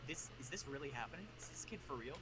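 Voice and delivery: low, hushed disbelief; retold hushed incredulity; disbelieving aside. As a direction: confide it softly, as a secret aside. Pranksters' stunned aside to each other.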